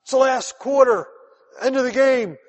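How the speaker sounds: rally the team like a coach in the last quarter; fired-up coach. Retold or imitated. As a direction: coach firing up his players in the final quarter, loud and urgent. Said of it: "the coach will get the guys together".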